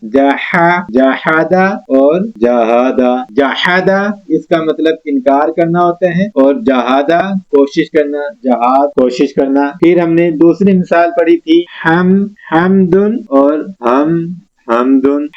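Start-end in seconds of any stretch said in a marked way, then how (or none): 0.9-3.3 जहादा once with a heavy throat हा, once light; slow, drawn-out contrast of the two जहादा; ha contrast in jahada. Shown as a demonstration. Tutor contrasting two jahada words, slow. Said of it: "इसकी मिसाल देखें". 12.5-15.3 हमदुन said slowly, heavy हा then light हा; ha contrast in hamdun. Shown as a demonstration. Tutor contrasting the two hamdun slowly. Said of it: "हा से अदा करेंगे".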